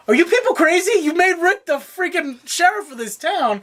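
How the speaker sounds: high-pitched, exasperated disbelief; incredulous. Retold or imitated. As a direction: challenge them as if they've lost their minds. Retells own disbelief at characters.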